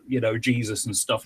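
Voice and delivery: normal talking voice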